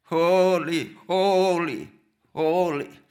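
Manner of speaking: "Holy" each time loud, falling, a solemn cry; seraph crying out. Acted. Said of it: "one seraph crying to another seraph".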